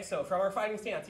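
ordinary talk

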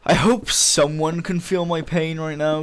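annoyed. Real, not acted. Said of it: "I'm so annoyed".

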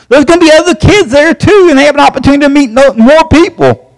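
excited urging. Real, not acted. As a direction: sell it with rising excitement.

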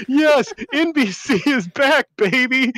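high, slow, gleeful crowing; mock celebration. NBC specialist celebrating a long-awaited report, mock triumph. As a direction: crow it like a hammed-up victory cheer.

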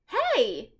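a sudden loud hey, voice jumps; sharp hey. Acted. Jack stopping Annie from biting the mango, loud. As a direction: stop her with a quick loud shout. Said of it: "said Jack, grabbing the mango".

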